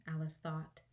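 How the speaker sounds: usual speaking voice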